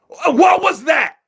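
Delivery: high, slow indignant yell; outraged shout. Real, not acted. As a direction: demand an answer, furious. Outraged at the ref.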